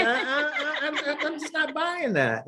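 high, slowed, laughing through the words; mock disbelief broken by laughter. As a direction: scoff and laugh at the idea while saying it. Retelling his own doubtful reply.

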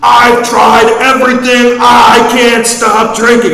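very high, loud, slow desperate scream. Retold or imitated. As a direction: scream it out in despair. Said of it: "this guy's screaming at me".

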